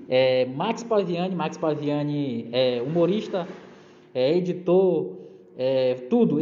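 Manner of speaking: slow, drawn-out introduction; grand entrance announcement. Acted. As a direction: stretch each word like a host. Host introduces the guest slowly.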